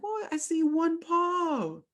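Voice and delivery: very high, slow, silly voice; drawn-out cartoonish wonder; a clueless squeak at one paw; mock naive. Mocks own slow reaction.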